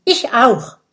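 loud eager chiming in; eager agreement. A narrator's reading. Dragon friend joining the outing, eagerly chiming in. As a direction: jump in loudly, keen to join. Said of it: "stimmt Oskar ein".

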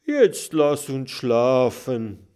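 slow yawned words; sleepy little dragon yawning. A narrator's reading. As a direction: yawn through the line. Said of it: "Kokosnuss gähnt".

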